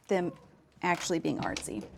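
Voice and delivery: usual speaking voice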